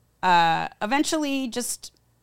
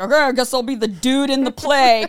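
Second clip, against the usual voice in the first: mock dude voice; 5 semitones higher, 8 dB louder than usual. Joue le rôle du garçon.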